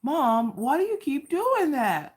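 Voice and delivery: high, drawn-out whine; imitating upset son. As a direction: whine in protest like a spooked kid. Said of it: "Did I scare you?".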